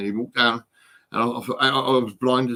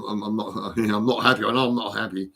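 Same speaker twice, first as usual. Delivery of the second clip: ordinary talk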